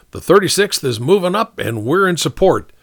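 high, energetic officer; captain briefing. Acted. Captain passing on the colonel's fire mission, businesslike. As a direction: pass on orders briskly.

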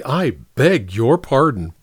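ordinary delivery

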